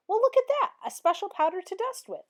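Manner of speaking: delighted to find a special powder; excited exclamation. A narrator's reading. Amelia Bedelia discovering dusting powder, excited and high. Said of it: "exclaimed Amelia Bedelia".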